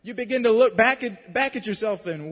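normal talking voice

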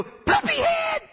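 put-on very high, loud, drawn-out kid shout; seven-year-old child. Seven-year-old joining in from the back seat, high stretched-out shout. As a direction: squeal it slowly like a copycat child. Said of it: "And my seven-year-old go".

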